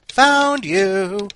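loud, high triumphant line; priest's voice. A narrator's reading. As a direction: call it out with glee. Priest finds the zombie.

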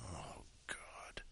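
breathless whispered oh God; whispered groan at her own outburst. Real, not acted. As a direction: whisper it, full of regret.

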